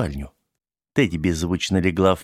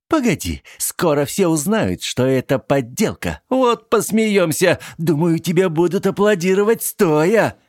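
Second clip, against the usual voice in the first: high, taunting sneer; 11 semitones higher, 3 dB louder than usual. Acted. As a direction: taunt him cruelly. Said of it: "Тедди продолжала его дразнить".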